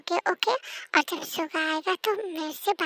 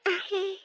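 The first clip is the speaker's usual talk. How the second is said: a small tearful whimper; much slower than usual. Acted. Shy girl whimpers to the boss, teary.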